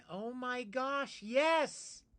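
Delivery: high, loud, slow delighted exclamation; thrilled yes. Real, not acted. Reacting to a fan's old show pick, delighted cry. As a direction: burst out with delight.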